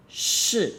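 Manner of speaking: "Shi" high and falling sharply; angry fourth tone. Shown as a demonstration. Teacher introducing the next word, angry fourth tone. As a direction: punch "Shi" from high down, short. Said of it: "angry fourth tone".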